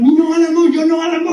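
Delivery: high-pitched comic begging yell; imitating desperate surrender cries; mock pleading shouts. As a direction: cry out like a fleeing soldier, hammed up. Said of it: "Les gritaban".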